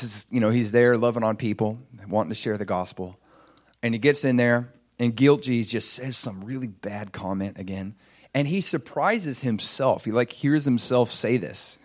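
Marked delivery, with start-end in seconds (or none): none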